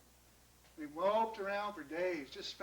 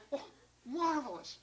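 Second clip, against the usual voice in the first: high, slow, savoring delight; rapturous; 6 semitones higher than usual. Acted. Tasting wonderful little fruit, rapturous. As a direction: linger on "marvelous".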